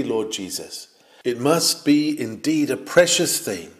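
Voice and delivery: ordinary talk, nothing marked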